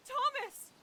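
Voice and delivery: very high urgent call; alarmed. Acted. Woman alerts Thomas to what she found, alarmed. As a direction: call him over in high-pitched alarm.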